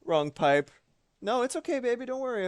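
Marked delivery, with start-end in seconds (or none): 1.2-2.5 soft, high comforting coo; soothing high voice. Real, not acted. Comforting chat member.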